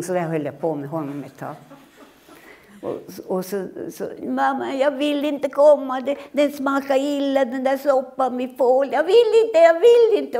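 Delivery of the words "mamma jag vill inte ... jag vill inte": put-on much higher, louder whining child rising to a scream; child refusing the soup. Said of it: "ungen skrika så här". (skrikande barn som vägrar)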